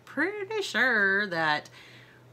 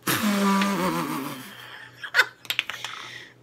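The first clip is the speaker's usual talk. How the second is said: breathless giggle. Real, not acted. Laughing while being sprayed.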